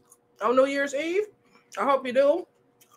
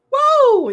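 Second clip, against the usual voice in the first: loud burp; 11 dB louder than usual. Real, not acted. Loud whoop, eating stream.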